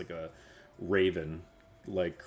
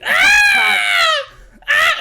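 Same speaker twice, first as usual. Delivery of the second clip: piercing high screams; mimicked woman's scream; 21 dB louder than usual. Kenku mimicking a woman's scream, shrill and loud. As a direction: shriek like a woman in terror. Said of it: "this Kenku has heard a woman scream".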